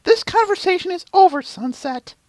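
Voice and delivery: very high-pitched, forceful character voice; firm Twilight Sparkle. Acted. Cutting off Sunset's argument, high and forceful. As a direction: shut it down in a loud, high heroine's voice.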